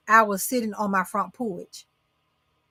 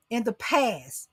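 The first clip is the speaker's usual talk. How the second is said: ordinary talk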